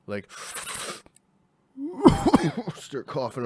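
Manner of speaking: sharp gasp into a coughing fit. Real, not acted. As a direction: choke and gasp for air. Coughing after the dab.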